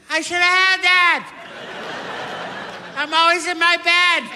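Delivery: high, loud exasperated wail; loud high self-reproach; frustrated self. Retold or imitated. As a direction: whine at the missed answer. Kicking himself at game show.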